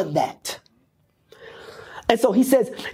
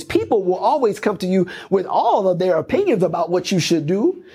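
ordinary talk, nothing marked

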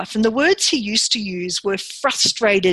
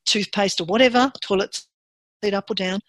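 normal talking voice

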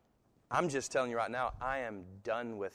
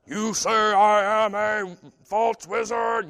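slow, loud, booming wizard rebuke, pitched higher; Gandalf impression; 9 semitones higher, 12 dB louder, slower than usual. Pastor mimics Gandalf rebuking Bilbo, slow loud wizard boom. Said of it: "I start being like Gandalf to Bilbo".

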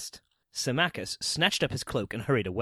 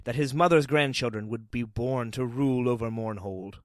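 plain reading voice

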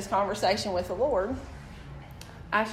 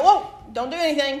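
alarmed shout; 7 semitones higher, 9 dB louder than usual. Retold or imitated. Retells stopping husband with orchid.

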